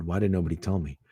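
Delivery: usual speaking voice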